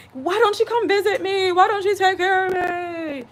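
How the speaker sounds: put-on high, pleading complaint to grown kids; whiny demanding parent. Mother imagining guilt-tripping her children, high and whiny. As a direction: nag like a needy old mother.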